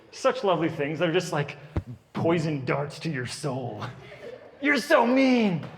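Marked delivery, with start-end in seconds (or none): 4.6-5.6 a hint of a sulking kid; whiny kid. Child while being dressed, a mild whiny pout. Comic quotes kids screaming insults.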